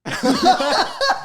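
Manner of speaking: loud high laugh; burst of laughter. Real, not acted. Cracking up at dad.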